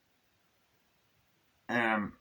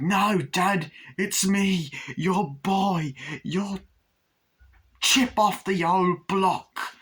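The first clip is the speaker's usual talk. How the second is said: high, loud pleading son voice, slowing at the end; imitating Glenn; 9 semitones higher, 4 dB louder, much slower than usual. Glenn to his father Chucky, high pleading voice. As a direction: beg for approval in a high childish voice. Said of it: "he's like".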